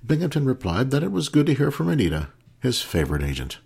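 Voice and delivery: plain reading voice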